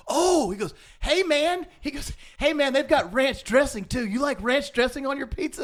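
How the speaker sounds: high and loud, eager and delighted; excited singer. Retold or imitated. Singer excitedly telling the fan about ranch dressing. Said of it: "him being so excited because he goes".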